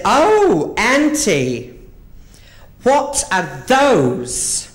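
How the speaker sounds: put-on high, loud little boy; wide-eyed four-year-old; child's voice. Nephew asking auntie, high child voice.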